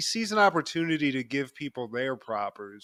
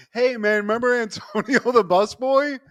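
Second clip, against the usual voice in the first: high, excited Kramer impression; 9 semitones higher, 5 dB louder than usual. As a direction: blurt it out eagerly. Kramer voiced, high and odd.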